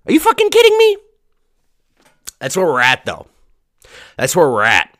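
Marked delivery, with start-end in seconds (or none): 0.1-1.0 high, loud disbelieving shriek; squealing outrage, voice jumping way up; outraged shriek. Real, not acted. Ranting at cancelled weekend.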